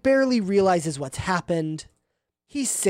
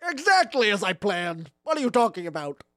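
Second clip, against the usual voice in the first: Grinch voice; 2 semitones higher than usual. Acted. Grinch defending himself.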